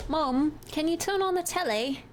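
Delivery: put-on whiny British kid calling out; British accent. Streamer plays British kid calling mom for TV, high British accent. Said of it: "British phrasing, telly".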